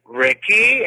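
high, loud hopeful call of his name; startled fan calling a stranger's name; excited call. Retold or imitated. Calling out to celebrity.

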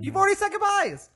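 high loud laughing protest; loud teasing. Real, not acted. Teasing cohost loudly.

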